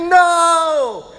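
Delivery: high loud flat "No"; mock stubborn no; loud refusal. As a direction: refuse outright. Disciples refusing.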